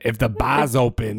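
mock Boston, "bar's" said as "bah's"; put-on Boston bartender; Boston accent. Shown as a demonstration. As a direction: drop the r like a Bostonian. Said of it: "it's very ba".

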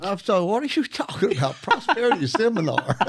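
high, loud laughing disbelief. Real, not acted. Laughing disbelief at prosperity seminar.

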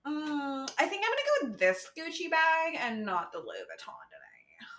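put-on airy Valley Girl accent, rising lilt; bubbly Valley Girl accent impression. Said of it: "have I ever done my Valley Girl accent for you?".

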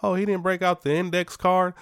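high, sarcastic quip, pitch jumps up; mock broadcaster jab. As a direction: tease like a TV announcer making a joke. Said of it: "they talk about that all the time on the broadcast as a joke".

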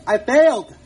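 loud, falling cry of failure; frustrated shout. Real, not acted. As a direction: blurt it out in frustration. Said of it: "I failed already this week".

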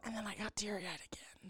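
soft, voiceless whisper; hushed little admission; whispered aside. Real, not acted. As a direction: whisper a confession under someone else's talk. Whispered aside over co-host's retelling.

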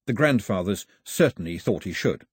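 ordinary delivery

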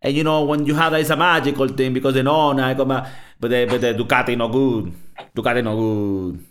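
hammed-up Italian accent. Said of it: "he's like".

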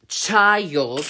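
put-on child drawn out, slow and weighty; slow down and lean on child; said slowly. Shown as a demonstration. Ranting vlogger repeats the word child slowly and deliberately. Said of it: "let me say it again, slowly".

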